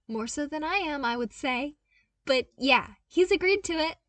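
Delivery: put-on upbeat young voice, proud of his find; eager young man. A narrator's reading. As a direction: pitch it up like an excited young engineer. Luke's voice again.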